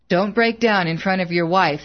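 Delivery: usual speaking voice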